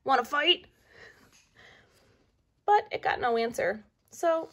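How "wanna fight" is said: loud high picking a fight; bully bug challenge; grouchy ladybug. A narrator's reading. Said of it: "the grouchy ladybug said".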